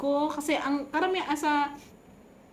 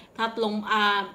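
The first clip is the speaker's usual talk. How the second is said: normal talking voice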